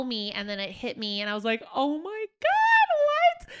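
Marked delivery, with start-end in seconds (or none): 1.7-3.5 very high, loud, drawn-out squeal; acting out stunned delight; shocked realization. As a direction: stretch it into an astonished shriek. Retold moment the ring hit her.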